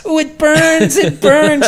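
high, loud hammed-up wailing; pained wail. Injured man telling woman in wood shop, pained wail. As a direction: whine loudly through mock agony.

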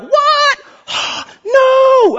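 very high shrieked shock with a gasp; screaming disbelief. Acted. Preacher acting the crowd hearing Jesus' story, screaming disbelief. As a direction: gasp and cry out in scandalised disbelief. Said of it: "I mean, screaming, crazy".

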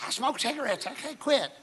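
imitating her in a high, piping voice; high-pitched confession. Woman repeating her confession to the preacher, high-voiced. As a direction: squeak it out, no longer whispering.

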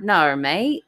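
Australian "No, mate" with "naur"; Australian accent. Shown as a demonstration. Coaching cohost on the word, Australian accent. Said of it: "like, a W and an R in it".

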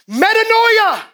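Metanoia thrown out high and loud; shouted metanoia. Acted. Preacher proclaims metanoia to the church, loud and high. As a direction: cry it to the back wall.

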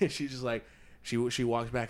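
usual speaking voice

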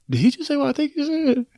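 high-pitched mock astonishment; put-on high, incredulous voice; retold shocked disbelief. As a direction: imitate an overhearer who can't believe it. Roommate's shocked reaction, acted in retelling.

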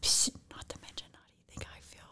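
hushed whisper. Real, not acted. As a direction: whisper so Dad can't hear. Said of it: "Why are you whispering?".

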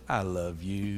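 tender declaration. Shown as a demonstration. Models husband's line to wife.

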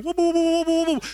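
high, whooping call, repeated; excited whooping. Retold or imitated. Friend running round the prayer room, high excited whoops. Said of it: "he'll run around going".

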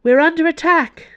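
loud, high-pitched cry of alarm; yelling. A narrator's reading. Nun warning the convent of attack, yelling. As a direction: raise the alarm at the top of your voice. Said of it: "yelled another nun".

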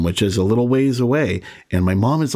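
ordinary delivery

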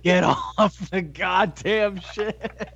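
slow, high, drawn-out yelled quote; Will Ferrell quote. Retold or imitated. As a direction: stretch it out like a comic outburst. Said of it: "Will Ferrell's S N L audition".

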